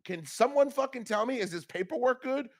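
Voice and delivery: high exasperated mock question; high exasperated retelling. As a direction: imitate his own disbelief. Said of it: "I'm like".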